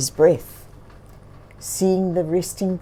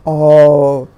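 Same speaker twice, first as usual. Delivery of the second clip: slow, louder, lightly voiced bear; bear reminiscing; 6 semitones lower, 8 dB louder, much slower than usual. A narrator's reading. Bear answering the bird, slow and fond.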